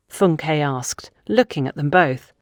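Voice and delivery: plain reading voice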